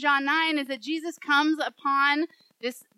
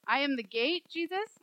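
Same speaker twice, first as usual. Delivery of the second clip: puzzled questioning. Acted. Listener questions Jesus about the image, mildly baffled. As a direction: query the line as if baffled.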